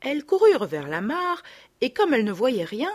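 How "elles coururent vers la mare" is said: plain reading voice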